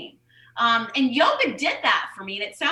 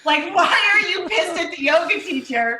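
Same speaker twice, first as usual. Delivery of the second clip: raised, louder scolding of herself; mock scolding; 6 semitones higher, 5 dB louder than usual. Scolding herself for being angry at the yoga teacher, raised and loud. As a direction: call yourself out with a mock yell.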